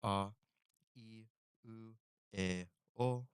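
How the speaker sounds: Japanese vowels ah, ee, oo, eh, oh sounded out; vowel drill. Shown as a demonstration. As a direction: drill the five vowels one by one. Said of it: "This is the exact reason".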